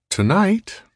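high, suspenseful showman's opening; theatrical rising stage voice; Riviera. A narrator's reading. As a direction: swoop up high to tease the audience. Riviera opens his stage act.

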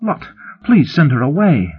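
high-pitched plea; urgent request, pitched well up; pleading. A narrator's reading. Companion begs for privacy.

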